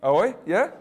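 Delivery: ordinary delivery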